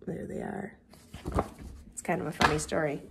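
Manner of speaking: hushed whisper. Acted. Teacher whispering about the last picture. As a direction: whisper it to the kids.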